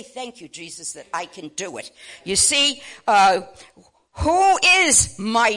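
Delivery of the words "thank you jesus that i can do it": quiet thanks. Shown as a demonstration. Models a grateful prayer.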